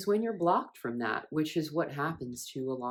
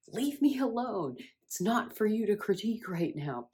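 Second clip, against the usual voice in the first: higher-pitched, mildly exasperated retort; retold anger; 6 semitones higher than usual. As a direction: act out an angry teenage comeback. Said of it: "I would get really angry".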